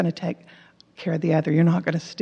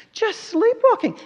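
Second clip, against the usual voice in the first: exclaimed high. Real, not acted.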